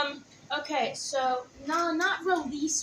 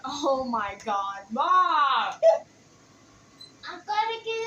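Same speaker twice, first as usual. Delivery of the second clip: shrieking with laughter; laughing; 4 dB louder than usual. Real, not acted. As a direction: call out mid-laugh. Daughter laughing hard, calling mom.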